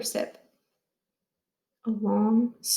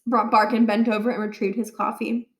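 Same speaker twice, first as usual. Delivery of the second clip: ordinary delivery, nothing marked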